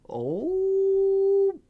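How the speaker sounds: a long high sliding oh; hammed-up surprised coo at the counterspell; mock swooning oh. Real, not acted. Reacting to opponent's Spell Snare.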